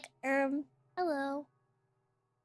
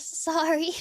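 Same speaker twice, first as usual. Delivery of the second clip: ordinary talk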